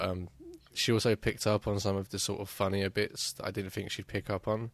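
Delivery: usual speaking voice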